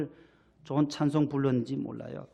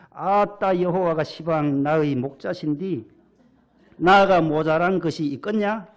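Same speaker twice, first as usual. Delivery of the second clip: playful attempt at a Jeolla accent; Jeolla dialect; 5 dB louder than usual. Pastor reads Psalm twenty-three to the congregation in Jeolla dialect. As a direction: read it like a Jeolla local. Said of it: "전라도 분들, 조금 지방마다 조금 다르는데".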